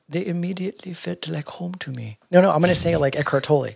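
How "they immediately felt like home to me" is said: put-on soft, higher English accent read. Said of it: "I'm gonna do these in an English accent".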